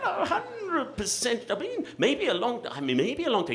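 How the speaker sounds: high, halting, caught-off-guard dithering; flustered stammer. Retold or imitated. Dad stammering when son asks about old video. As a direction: stall for time, caught out. Stunned dad fumbling for answer.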